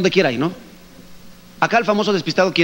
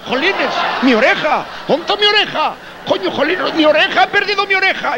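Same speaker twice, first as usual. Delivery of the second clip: pained yell; 11 semitones higher than usual. Retold or imitated. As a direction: howl over the lost ear. Gallego just cut off ear.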